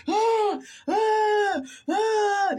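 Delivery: loud, very high-pitched mock sobbing wails; three drawn-out imitation bawls; imitated crying wail. Imitating own breakdown at the hospital, loud high wailing. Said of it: "Like, I was crying".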